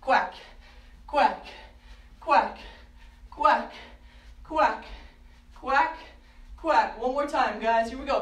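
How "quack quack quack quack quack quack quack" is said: loud, punchy duck quacking; duck quacks. Pretend. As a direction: quack out loud to get the kids quacking along. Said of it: "Let me hear your quacks".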